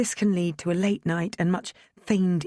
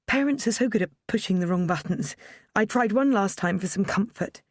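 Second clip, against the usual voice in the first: ordinary delivery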